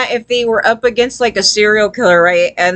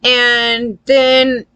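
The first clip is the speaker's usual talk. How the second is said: ordinary talk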